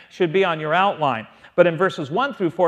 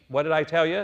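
ordinary talk, nothing marked

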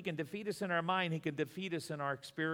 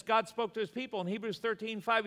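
ordinary delivery

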